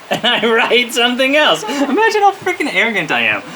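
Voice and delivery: high, laughing self-mockery; laughing disbelief. Real, not acted. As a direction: laugh in amazement at your own nerve. Laughing at own arrogance.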